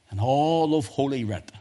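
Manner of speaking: ordinary talk, nothing marked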